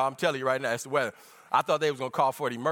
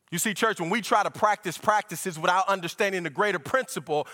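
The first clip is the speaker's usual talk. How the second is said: ordinary talk, nothing marked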